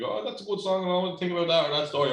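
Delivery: high-pitched, gushing imitation of the lads; mock local enthusiasm. Imitating Belfast taxi lads praising the rebel songs, high and gushing. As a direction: pitch it well up and gush like a chatty local. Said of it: "loads of them said to me".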